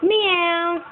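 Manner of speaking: high-pitched pretend meow; cat meow. Mother plays cat for her toddler, high meow. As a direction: mew high like a cat, drawn out.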